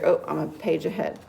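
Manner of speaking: ordinary delivery, nothing marked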